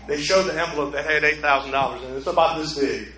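ordinary delivery

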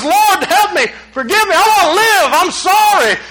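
high-pitched pleading prayer, acted out; dramatized plea, pitched high; desperate prayer. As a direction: cry out to God for mercy. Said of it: "he prays".

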